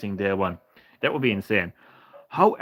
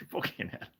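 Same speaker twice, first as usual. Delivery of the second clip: whispered curse; 12 dB quieter than usual. Real, not acted. Embarrassed at the Reggie slip.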